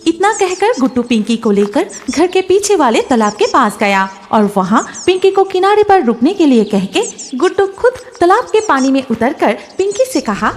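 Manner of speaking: ordinary delivery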